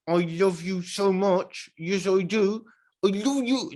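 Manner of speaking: doting high-pitched pet voice; cooing affection. Real, not acted.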